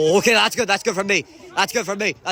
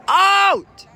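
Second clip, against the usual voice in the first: high, loud shouts of dismay; shouting no; 12 semitones higher, 4 dB louder, much slower than usual. Real, not acted. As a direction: yell it out as the chance goes. Commentator reacts to a chance.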